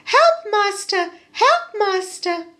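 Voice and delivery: high, loud desperate cry; harp crying for help. Acted. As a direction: squeal for help like a frightened harp. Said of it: "the harp cried".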